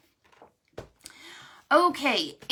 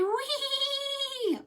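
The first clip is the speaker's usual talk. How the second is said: mock whee. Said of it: "he would say".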